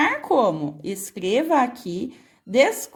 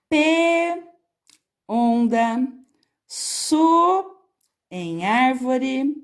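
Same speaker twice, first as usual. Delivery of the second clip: slow reading with unfinished words held and rising; leitura para completar; slower than usual. Shown as a demonstration. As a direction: read haltingly, leaving gaps for pupils. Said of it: "Leia o texto e complete". (leitura pausada, com sílabas suspensas e alongadas)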